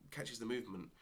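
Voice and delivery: normal talking voice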